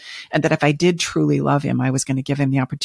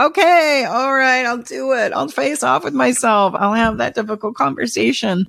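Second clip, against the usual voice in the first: reluctant giving in; 9 semitones higher than usual. Acted. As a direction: act out caving in, pitched well up. Voicing a reluctant client giving in.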